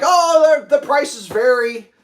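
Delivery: high, loud, slow clerk voice; imitating the clerk. Pawn shop clerk dodging about case prices, high and slow. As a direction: play the vague salesman.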